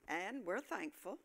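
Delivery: ordinary talk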